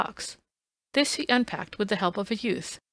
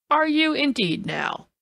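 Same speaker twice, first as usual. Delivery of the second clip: slow, louder bitter jab; bitter cry; 6 semitones higher, 4 dB louder, slower than usual. A narrator's reading. Lestrade stung by Holmes's doubt, crying out bitterly. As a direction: let the bitterness bite, slowly. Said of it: "cried Lestrade with some bitterness".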